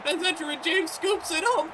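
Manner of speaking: climbing high as the return goes all the way; rising disbelief. Real, not acted. Gamer watches his onside kick returned, climbing high. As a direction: let it build to a high yelp.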